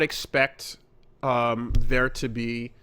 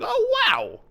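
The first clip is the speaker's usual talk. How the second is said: amazed. Retold or imitated. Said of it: "I was really like".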